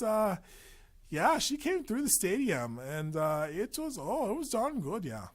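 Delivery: high-pitched, hammed-up Wisconsin-Canadian accent. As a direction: play a gossipy Packers fan with a Wisconsin accent. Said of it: "Wisconsin accent or a Canadian accent".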